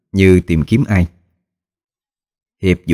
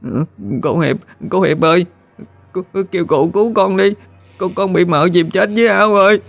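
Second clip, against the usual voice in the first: put-on very high child's wail; bursting into tears; 12 semitones higher than usual. A narrator's reading. Drowned boy begs Hiệp for help, crying. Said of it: "thì khóc òa lên".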